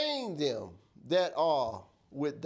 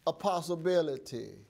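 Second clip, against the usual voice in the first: normal talking voice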